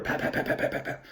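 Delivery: soft unvoiced pop pop pop, like heads breaking the surface; breathy popping noises for heads surfacing; imitating swimmers surfacing one by one; popping sounds.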